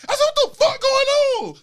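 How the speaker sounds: high, loud shout of disbelief; hammed-up outraged shriek; retold freak-out. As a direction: yell it high, half laughing. Retold freak-out after tackle.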